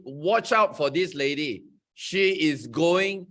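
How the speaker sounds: ordinary delivery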